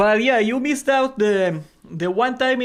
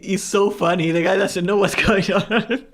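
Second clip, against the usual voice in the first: laughing while talking. Real, not acted. Laughing while telling story.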